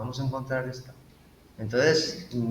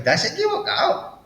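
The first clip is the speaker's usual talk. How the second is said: high, sing-song quote; mock confession; 3 dB louder than usual. Friend mimics admitting a mistake, high mock voice.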